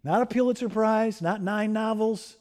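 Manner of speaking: ordinary talk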